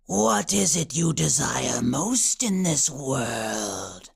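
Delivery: slow, low, whispery witch voice; Chaos Witch. A narrator's reading. Witch asks Oxwald his deepest desire, eerie whisper. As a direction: draw it out, low and tempting. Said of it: "the Chaos Witch whispered".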